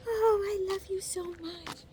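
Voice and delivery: very high, syrupy voice; mock gushing. Father and son gushing over each other, in a very high syrupy voice. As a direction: coo it like a sappy cartoon. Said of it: "They're all like".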